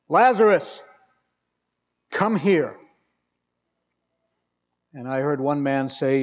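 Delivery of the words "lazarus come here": loud, raised call toward the tomb; commanding call. Retold or imitated. Jesus calling dead Lazarus out of the grave, loud command. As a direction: call him out with full authority.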